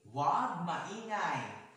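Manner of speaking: loud, high, drawn-out classroom rule; stretched, projected instruction; calling out a rule. Real, not acted. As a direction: announce it slowly to the whole room. Teacher reads out class rule.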